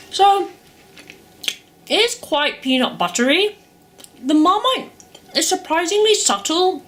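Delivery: talking through a mouthful; words round a bite of toast; mouth full. Real, not acted. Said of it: "hard to talk with your mouth full".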